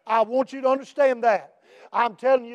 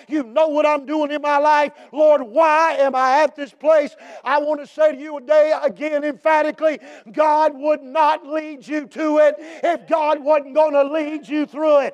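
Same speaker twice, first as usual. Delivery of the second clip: preaching shout; 3 semitones higher than usual. Acted. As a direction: declare it with full force.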